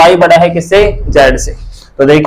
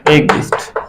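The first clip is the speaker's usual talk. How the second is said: एक दिष्ट breathed out softly, almost whispered; soft repeat; 2 semitones lower, 6 dB quieter, slower than usual. Shown as a demonstration. Teacher writing on the board, murmured repeat. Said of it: "repeating the Hindi term".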